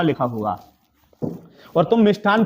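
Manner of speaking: ordinary talk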